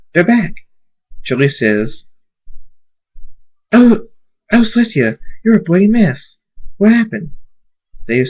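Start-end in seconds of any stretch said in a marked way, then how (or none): 0.1-0.5 high, loud call; excited announcement. Acted. Applejack telling everyone they're back, high excited call. 3.7-7.3 very high, raised voice; gasping concern pitched high and loud; alarmed exclamation. Acted. Chili seeing the bloody mess, high alarmed voice. Chili sees injured Dave.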